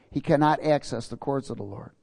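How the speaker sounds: ordinary talk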